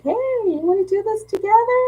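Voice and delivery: high, sing-song retold invite; eager invitation. Someone invites her to team up, high and eager. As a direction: pitch it up high, playfully eager.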